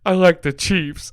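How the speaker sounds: high, slow mock-crying kid; drawn-out tearful wail, hammed up; sobbing son. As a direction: imitate a tearful child's confession. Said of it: "And he's sobbing, crying".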